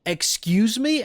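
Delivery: high, loud, drawn-out disbelieving question; stretched, incredulous outburst rising high; incredulous reaction. Retold or imitated. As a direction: reenact stunned disbelief, pitch shooting up. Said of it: "because I was like".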